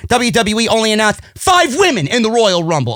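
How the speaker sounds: ordinary talk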